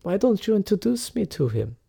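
a lightly voiced elegant widow; genteel lady. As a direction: invite him to sit, politely. [name] speaks.

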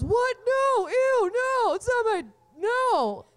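high, loud, squealing denial; brother's panicked denial. Retold or imitated. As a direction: recoil from it like a grossed-out teen brother. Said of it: "And he goes".

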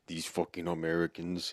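put-on a faint, barely-there French accent; lightly mock a French sneer; bad French accent. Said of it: "That was the worst fucking French accent".

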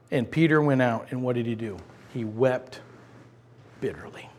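Peter's weeping told in a dying whisper; fading hush. Acted. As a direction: let it fade into silence. Hushed narration of the weeping.

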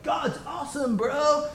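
a hint of a laid-back surfer saying it; mock surfer bro. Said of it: "as if Jesus was a surfer".

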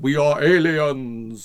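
slow, drawn-out mock alien announcement. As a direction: declare it loudly as a hammy sci-fi alien. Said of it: "we all talk like this".

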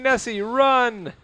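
high, loud drawn-out yell; shouting. Acted. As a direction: call out to warn her. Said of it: "I'm shouting".

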